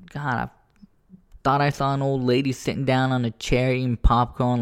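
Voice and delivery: ordinary talk, nothing marked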